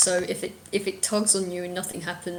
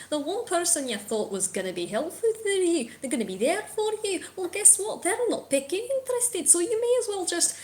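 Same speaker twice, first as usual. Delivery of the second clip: taunting bird; 6 semitones higher than usual. Acted. Bird taunting the party about their guild, mocking. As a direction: goad them, rising as you go. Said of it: "the bird keeps talking".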